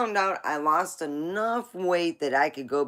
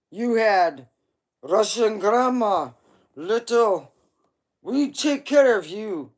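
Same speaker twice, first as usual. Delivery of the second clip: put-on louder, higher grandma with a Russian accent; 6 semitones higher, 10 dB louder than usual. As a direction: reassure like a Russian grandma who feeds you. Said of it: "if you told your grandma".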